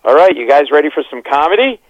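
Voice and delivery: high, loud emcee hype; MC announcement. Retold or imitated. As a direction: sell it like a club host. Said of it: "I hear the M C go".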